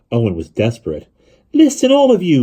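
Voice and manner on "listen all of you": loud high call to the crowd; shouting. A narrator's reading. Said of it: "he shouted".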